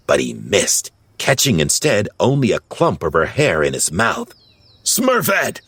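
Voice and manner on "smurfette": high, alarmed call of a name; worried call. A narrator's reading. Papa sees the cat snap at Smurfette, calling worriedly. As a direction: call out in worry, pitched way up. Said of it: "Papa called out worriedly".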